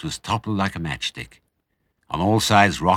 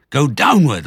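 loud, higher urgent command; commanding order; 8 semitones higher, 7 dB louder than usual. A narrator's reading. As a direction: bark out the order. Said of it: "ordered Mister Fox".

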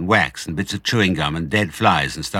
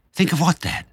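put-on child fox voice; 11 semitones higher than usual. A narrator's reading. Child fox asks father.

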